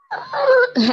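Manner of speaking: a sudden high, choked sob-laugh; choked cry. Real, not acted. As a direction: let a cry break out of the laugh. Breaks down recalling sin. (tangis yang tiba-tiba pecah)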